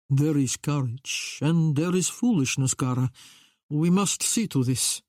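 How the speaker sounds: measured Italian man, gently reproving; Italian accent. A narrator's reading. Italian attendant cautioning his queen, Italian accent. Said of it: "he said, in an Italian accent".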